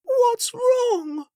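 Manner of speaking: high, anxious mother's voice; worried cry. Acted. Mother asking her sick son what's wrong, anxious. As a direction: ask in real alarm. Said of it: "she cried".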